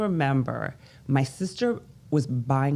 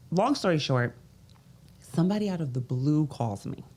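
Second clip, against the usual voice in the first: ordinary talk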